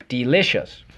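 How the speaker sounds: ordinary talk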